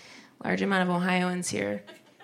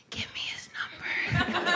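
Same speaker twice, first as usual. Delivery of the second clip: high, eager whisper; whispered, higher; 11 semitones higher, 8 dB quieter, slower than usual. Retold or imitated. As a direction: ask for the number in a hushed, eager voice. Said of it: "I was just like".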